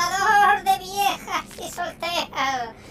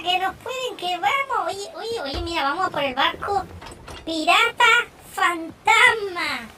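ordinary delivery

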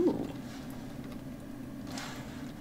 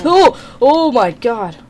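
sudden loud fright; startled scream; 5 semitones higher, 14 dB louder, slower than usual. Real, not acted. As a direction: jump out of your skin. Startled by monster.

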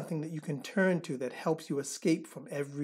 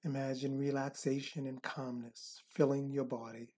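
soft spa whisper; 3 semitones lower, 8 dB quieter than usual. Acted. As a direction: guide them into calm, breath by breath. Leads a counted relaxation meditation.

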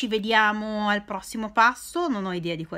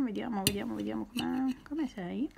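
quiet; 10 dB quieter than usual. Real, not acted. Talking softly to the food.

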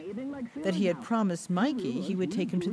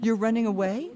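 surprised; 5 semitones higher, 6 dB louder than usual. Acted. Aunt hears uncle plans to escape.

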